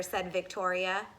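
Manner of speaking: ordinary delivery, nothing marked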